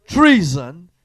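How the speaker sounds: loud rising cry of treason; cried treason. Acted. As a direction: cry it out like a betrayed queen. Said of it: "Athaliah rent her clothes and cried".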